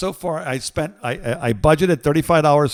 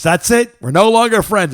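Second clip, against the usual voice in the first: huffy quote; 6 semitones higher, 10 dB louder than usual. Retold or imitated. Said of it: "And she goes".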